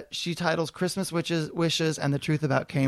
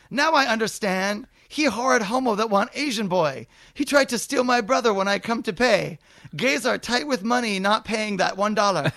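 shrieking about a one-dollar balance; mock Vietnamese mother; 5 semitones higher than usual. Mrs Nguyen complaining to the Kmart manager, higher mock accent.

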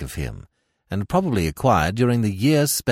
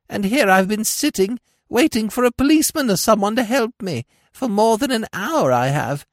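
whined; 13 semitones higher, 4 dB louder than usual. A narrator's reading. Old woman whines to Smith about her fall. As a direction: whimper like a hurt old hag. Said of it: "whined the old creature".

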